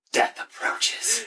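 slow, whispered doom; creepy toy voice. Pretend. A friend teasing that the Furby echoes the gloom, in a slow whisper. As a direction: breathe it out like a haunted toy.

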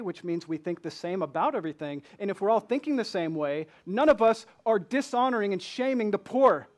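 normal talking voice

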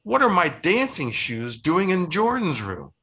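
big sister shouting about her missing shoes; screams. A narrator's reading. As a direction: cry out in storybook outrage. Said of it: "Olivia screams".

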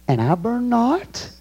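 "And I burn not" slow and high, rising on "not"; questioning rise. Shown as a demonstration. Preacher showing the question mark, "not" rising high. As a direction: lift "not" into a pointed question. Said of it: "It's a question mark".

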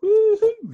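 shrill very high loud woo-hoo squeal; mock squeal. Host imitating a winner's squeal, very high and loud. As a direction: squeal it like a triumphant strongman. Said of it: "He does this remarkable squeal".

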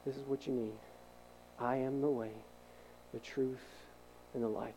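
hushed, patient Jesus calming a tangled heart; gentle voice of Jesus. Retold or imitated. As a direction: speak it close and soft, like a comforting promise. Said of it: "and he said".

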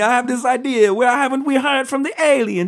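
very high, loud hammed-up Italian accent. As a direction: play Fermi with a big Italian accent. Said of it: "He's like".

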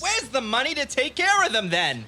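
heated yelling match; angry shouting. Acted. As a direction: yell it back in fury. Acted quarrel, both shouting.

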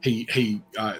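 normal talking voice

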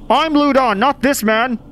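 high-pitched protesting voice; person inside house. Acted. Person inside disowning the man outside, protesting. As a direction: protest your identity, pitched well up. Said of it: "says the person from inside the house".

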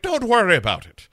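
high, loud, brisk Irish voice; hammed-up Irish accent. Sarah brushing off questions about Cam, mock Irish accent. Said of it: "inherited this Irish accent from".

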